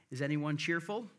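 normal talking voice

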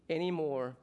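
normal talking voice

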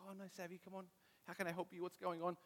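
soft, hushed parental concern; gentle coaxing. Retold or imitated. Dad to crying daughter in her room, gently coaxing. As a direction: coax a crying child very quietly.

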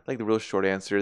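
ordinary talk, nothing marked

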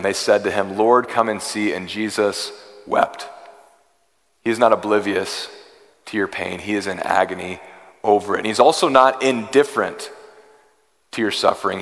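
loud, driving sermon delivery, pushing every phrase out to the congregation; full-force preaching. Acted. Preacher to a suffering congregation, loud impassioned preaching. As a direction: preach it hard and fast, pleading with the room.

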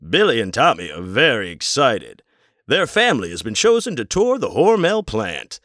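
old film narrator, voiced high and bright; hammed-up vintage voiceover; mock narrator. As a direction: ham up a cheesy training-film narrator. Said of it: "narration voiceover is like".

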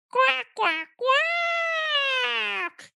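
high imitation quacks, last one long drawn out; duck quack. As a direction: quack like a toy duck, stretching the end. Duck answers sick child's emoji.

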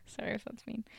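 soft whispered aside; hushed embarrassed aside; whispered apology. Real, not acted. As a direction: whisper a sheepish sorry. Apologizing after laughing.

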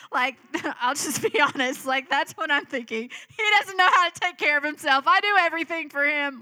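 real laughter through the words; laughing confession. Real, not acted. Laughs while confessing her thoughts.

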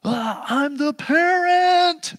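high, loud, drawn-out parental wail; hammed-up parent losing it; exasperated parent. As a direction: act out a parent at the end of their rope. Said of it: "you're just done with your kids".

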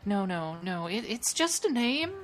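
flustered. Acted. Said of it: "blanches a bit".

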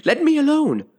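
high, loud indignant demand; indignant girl crying out. A narrator's reading. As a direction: push them away with your voice. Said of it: "said Alice indignantly".

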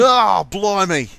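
loud, high, drawn-out Australian caricature; mock Australian accent. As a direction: holler it in a hammed-up Australian accent. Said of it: "You kinda sound Australian there".

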